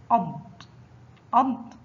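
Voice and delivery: أض cut short on a heavy closing ḍad; letter with sukun. Shown as a demonstration. Teacher shows how ḍad with sukun sounds, أض as one closed syllable. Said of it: "حرف الضاد فوقه سكون ينطق".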